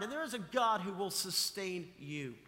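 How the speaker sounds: usual speaking voice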